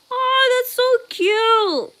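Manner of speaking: high, drawn-out adoring aww; high-pitched gushing, slowing at the end; cooing. Real, not acted. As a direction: coo over something adorable. Cooing over a cuddle idea.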